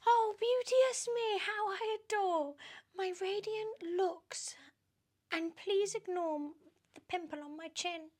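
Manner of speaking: very high, sneering witchy voice; high mocking hag voice; spiteful Aunt Spiker. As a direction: jab at her sister with a high sneer.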